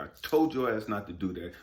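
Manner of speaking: usual speaking voice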